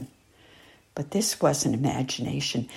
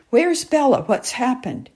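alarmed; 8 semitones higher, 5 dB louder than usual. Acted. Boy alarmed, nanny missing.

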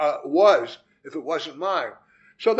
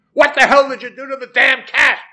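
high, loud accusing yell; angry yell; 7 semitones higher, 11 dB louder than usual. A narrator's reading. Husband yelling at wife over the dead cat, furious yell. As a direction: yell it in outrage. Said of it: "That's what I yelled".

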